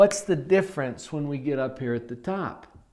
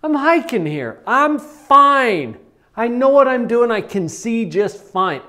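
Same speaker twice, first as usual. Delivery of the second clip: put-on defensive teen; 6 semitones higher, 8 dB louder than usual. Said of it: "you're like".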